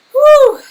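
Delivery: high, loud whooped exhale; loud whew. Real, not acted. Streamer recovering from happy tears, high loud whew. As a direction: blow off emotion with a piercing "whoo". Letting out emotion after tears.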